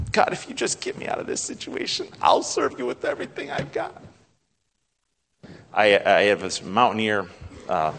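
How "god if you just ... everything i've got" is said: high, hushed, strained bargaining; acted desperate plea. Preacher imitating someone bargaining with God, acted desperate plea. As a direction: plead with God in a small high voice.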